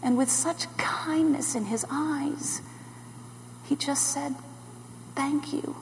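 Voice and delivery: very soft, reverent hush; tender awe. Acted. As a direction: quote the thanks softly and tenderly. Tender memory of Jesus' thanks.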